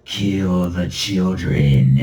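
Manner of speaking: low, slow demonic voice; slow low demon command. Pretend. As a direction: growl it like a movie demon. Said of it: "a little demon's flying around and it's like".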